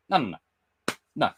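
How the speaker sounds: ordinary talk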